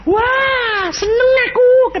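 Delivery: high, loud cry of joy; mother's joyful cry. Retold or imitated. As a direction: shout it out like an overjoyed mother. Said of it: "dia bilang". (seruan gembira ibu yang tinggi dan keras)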